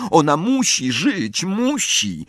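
high, distressed plea; desperate insistence. Acted. Hermione desperate for Luna to live. (rozpaczliwe, wysokie naleganie)

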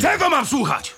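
high, sharp shout. A narrator's reading. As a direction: shout back in anger. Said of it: "Krzyknął Wiedźmin".

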